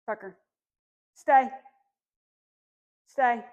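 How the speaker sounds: loud, firm stay for the puppy; firm command. Real, not acted. Trainer firmly telling Tucker to stay. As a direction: plant the word, firm and loud. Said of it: "in a pretty firm tone".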